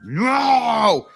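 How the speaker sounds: high, loud monstrous cry of pain; the blinded ooze creature screaming; creature's cry. Acted. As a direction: howl it like a blinded beast. Creature cries out in blast.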